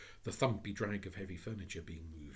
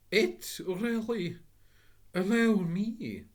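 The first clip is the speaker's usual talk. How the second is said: higher, louder, slow; flustered gentleman; 13 semitones higher, 12 dB louder, much slower than usual. Acted. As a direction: lose words then recover.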